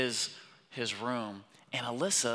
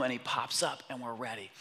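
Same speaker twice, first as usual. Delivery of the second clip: ordinary talk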